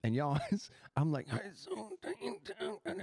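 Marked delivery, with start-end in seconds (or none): 1.4-3.0 strangled, much softer attempt at words; choked voice. Man acting out his stopped throat while praying, choked. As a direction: force words through a blocked throat. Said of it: "something was stopping my throat".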